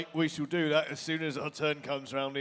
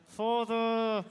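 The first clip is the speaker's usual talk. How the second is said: put-on French accent; 6 semitones higher than usual. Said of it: "it's hard to do a French accent when you don't move your jaw".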